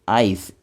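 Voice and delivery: आइस said slowly and clearly; "आइस" साफ़ उच्चारण में बोलें; आइस spoken clearly. Shown as a demonstration. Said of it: "इस तरह से ये प्रोनाउंस किया जाता है". ("आइस" धीरे और साफ़ बोलें)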